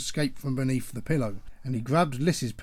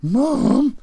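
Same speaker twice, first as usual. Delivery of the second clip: loud, very high outraged shout; roar; 13 semitones higher, 10 dB louder than usual. Acted. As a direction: scream at her mother. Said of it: "Chevlon roared".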